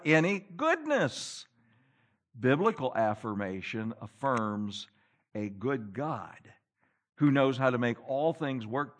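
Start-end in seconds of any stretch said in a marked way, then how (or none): none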